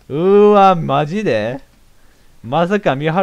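loud, high gasp of shock. Real, not acted. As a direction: react to the twist with a big ugh. Shocked at culprit reveal. (犯人が判明して驚く大きな声)

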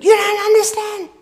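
high, loud imitation of an aggrieved man; outraged protest. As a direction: act out shouting back in hurt indignation. Doug's protest acted out again.

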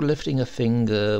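usual speaking voice

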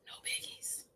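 hushed, breathy whisper for suspense; whispered reveal. Acted. Teacher whispering the twist to the class. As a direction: whisper the bad news.

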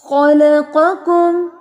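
heavy-letter खलक़कुम recited slowly; heavy kha and qaf. Shown as a demonstration. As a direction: stretch खलक़कुम, making kh and q heavy. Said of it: "क़ाफ ये भी heavy है".

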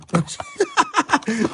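sudden high-pitched burst of laughter. Real, not acted. Streamer at a rare pull, high laugh.

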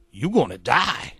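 menacing voice. Acted. Note's menacing signature.

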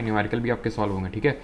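usual speaking voice

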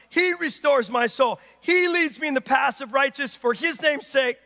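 emphatic preacher, voice up; full-force preaching. Acted. Preacher telling the congregation of unconditional love, full-force preaching. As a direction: drive it home to the congregation.

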